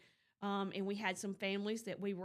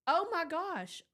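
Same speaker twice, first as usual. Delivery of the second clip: higher, louder retelling of a stunned gasp; shocked winner; 7 semitones higher, 6 dB louder than usual. Retold or imitated. Winner on the phone hearing she won, shocked and higher. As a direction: replay the winner's disbelief, pitched up. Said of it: "She was so just shocked".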